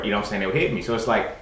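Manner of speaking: normal talking voice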